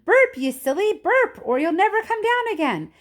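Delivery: mock shout from the ground. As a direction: yell up after the floating Oompa Loompa. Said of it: "I stood there shouting".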